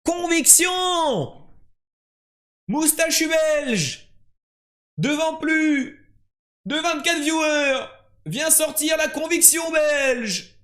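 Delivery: proclamation lente et criée, très aiguë; clame-le comme un présentateur de ring; annonce triomphale. Acted. (annonce de victoire hurlée)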